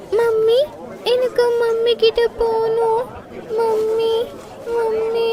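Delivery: put-on high, slow child's whimper; a lost toddler calling for mummy; crying little girl. Lost little girl cries for her mother, high childish voice.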